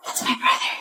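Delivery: quiet unvoiced whisper; hushed aside. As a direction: breathe it so no one hears. Whispered confession.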